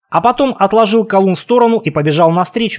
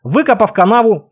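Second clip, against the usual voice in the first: usual speaking voice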